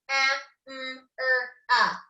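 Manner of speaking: "A, mm, er, ah" as isolated sounds with gaps; sounded out. Shown as a demonstration. Teacher sounding out camera for pupils, "A, mm, er, ah" one by one. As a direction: say each sound alone: "A, mm, er, ah". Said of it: "sand out the words one more time".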